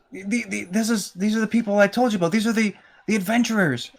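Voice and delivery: high, stammering, eager presentation; excited introduction. Acted. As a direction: introduce your heroes to children, a touch starstruck. Gimbal presents heroes to the kids.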